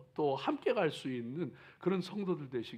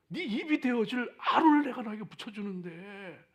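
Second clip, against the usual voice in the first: raised, exasperated divine scolding; stern rebuke; 9 semitones higher, 6 dB louder than usual. Retold or imitated. God rebuking Moses quoted.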